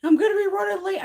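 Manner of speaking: high, loud retold text message; imitating a worked-up friend; mock complaint. Voices friend's late-night text.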